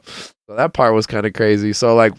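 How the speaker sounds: ordinary talk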